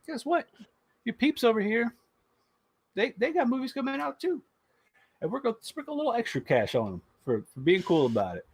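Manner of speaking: high, teasing taunt, pitch climbing; mocking corporate voice. Disney taunting stars who stay loyal, high smug teasing.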